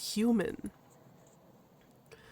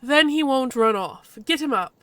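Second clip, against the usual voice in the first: higher, louder order; commanding clan figure; 4 semitones higher, 6 dB louder than usual. Acted. Clan leader orders the captive raised, commanding. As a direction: give the order briskly.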